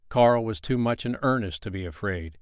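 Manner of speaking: plain reading voice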